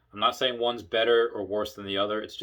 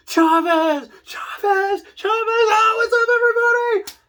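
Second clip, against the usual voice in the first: high, loud, whooping calls of her name; cheering greeting; 8 dB louder than usual. Retold or imitated. Coworkers loudly cheering Chavez as she arrives, whooping high and loud. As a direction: call out Chavez like an excited crowd.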